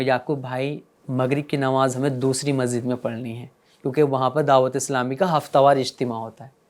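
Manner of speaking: soft, low, kindly address; gentle, affectionate. Shown as a demonstration. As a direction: speak lovingly to someone standing close. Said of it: "پیار سے بولوں گا".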